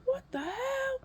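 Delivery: very high-pitched incredulous cry; retold shocked outburst. Patient shocked by the drug, high-pitched outburst. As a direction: squeal it in mock alarm.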